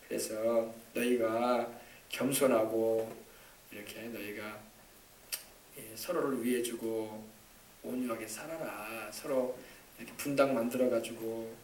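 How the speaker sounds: soft, low pastoral exhortation; tender. Real, not acted. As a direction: gently coax the congregation.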